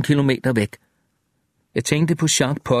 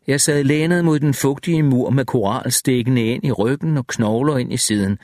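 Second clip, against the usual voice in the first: ordinary delivery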